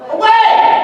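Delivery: high, loud heroic cry; superhero shout. Acted. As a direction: call it out like a hero swooping in. Superhero flying rescue call.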